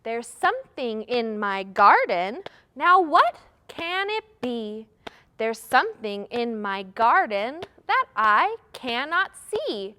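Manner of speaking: rhythmic, emphatic recital with a lifted question; playful guessing rhyme; children's rhyme. Shown as a demonstration. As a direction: chant it to a steady beat for kids. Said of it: "I know a little rhyme".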